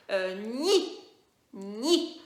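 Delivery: put-on loud, drawn "ni" for children; teacher sounding out "ni"; syllable drill. Shown as a demonstration. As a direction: sound out "ni" clearly for learners. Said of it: "on en fait une autre".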